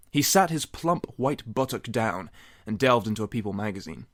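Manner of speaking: plain reading voice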